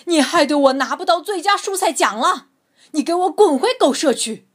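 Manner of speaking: fast, loud, furious roar; growled warning. A narrator's reading. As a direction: rage at the dog for the ruined prize. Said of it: "皮特咆哮道".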